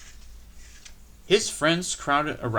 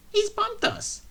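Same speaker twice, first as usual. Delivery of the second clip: very high shrieking coaches; spiteful coaches, much higher; screaming coaches. A narrator's reading. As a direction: scream it in outrage. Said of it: "screamed the coaches".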